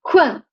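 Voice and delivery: Hun dropping sharply; fourth-tone falling Hun. Shown as a demonstration.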